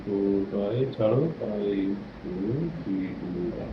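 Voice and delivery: soft, slow English count fading away; slow breathing count. Shown as a demonstration. Coach counting breaths in English, soft and slow. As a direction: count gently down to a hush. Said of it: "এইভাবে আস্তে আস্তে শ্বাস নেবো".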